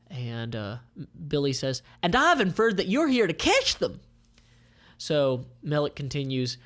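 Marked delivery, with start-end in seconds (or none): 2.0-4.0 much higher, louder sly voice; high, cocky mercenary; Billy's voice. Acted. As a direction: show off a clever guess. Billy character voice.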